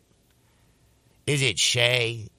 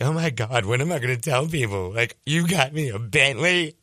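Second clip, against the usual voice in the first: mock overwhelmed cry, pitched up and louder by the end; imitating tearful girlfriend; 4 semitones higher, 4 dB louder than usual. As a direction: act out a girlfriend bawling with joy, stretching the last words. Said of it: "she just started crying".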